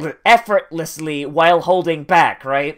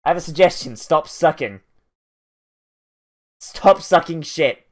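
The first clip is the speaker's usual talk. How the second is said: normal talking voice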